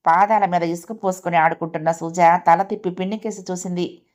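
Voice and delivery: ordinary delivery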